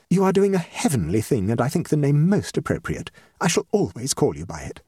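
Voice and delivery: lightly voiced gushing hostess; society hostess, grateful. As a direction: play a hostess declaring new friendship.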